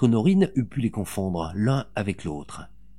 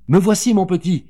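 high, loud urgent call; 9 semitones higher, 8 dB louder than usual. A narrator's reading. Mother to her captured boy, urgent calling. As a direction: call out to reach your child.

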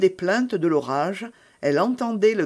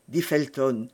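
ordinary delivery

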